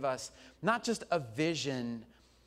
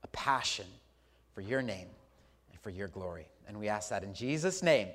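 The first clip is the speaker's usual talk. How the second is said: prayer; 8 semitones lower than usual. Real, not acted. Closing prayer.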